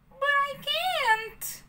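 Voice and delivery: high, slow pleading puppet; whiny puppet boy. A narrator's reading. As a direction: plead like a scared little boy. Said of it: "cried Pinocchio".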